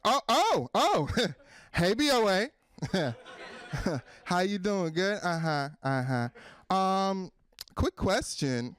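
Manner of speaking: mock-sweet ex, high opening ohs; bitter ex-girlfriend. Jilted ex greeting the bank, bitter fake friendliness. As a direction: feign delight at running into an old flame. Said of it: "like a bitter ex-girlfriend".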